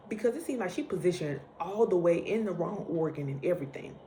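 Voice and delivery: ordinary talk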